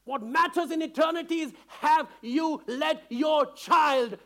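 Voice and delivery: impassioned pulpit voice; fiery preaching. Acted. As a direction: preach it out to the church at full force. Preaching at full force.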